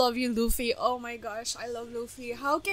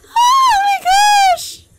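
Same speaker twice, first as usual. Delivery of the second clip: very high, loud squeal; overwhelmed; 8 dB louder than usual. Real, not acted. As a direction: shriek it as an overwhelmed fan. Overwhelmed fan reacting to anime.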